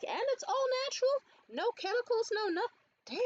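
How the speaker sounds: can't believe it's all natural; amazed disbelief. Retold or imitated. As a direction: her braider asking in amazed disbelief, high. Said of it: "she was like".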